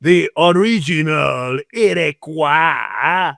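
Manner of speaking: slow, drawn-out Iroquois, put-on vowels; mock original pronunciation of Iroquois. Teasing about original Iroquois speech, slow mock pronunciation. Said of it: "Like how the, uh, original Iroquois".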